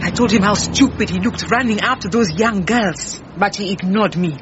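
usual speaking voice

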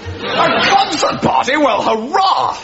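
high, drawn-out eager delight; slow, swelling whoop of enthusiasm; delighted cheer. Acted. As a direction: cheer the plan like a keen schoolboy. Lieutenant cheers the concert party plan.

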